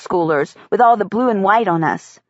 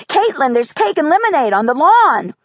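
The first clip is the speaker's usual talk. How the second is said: put-on eager little boy; 6 semitones higher than usual. Michael voiced as child.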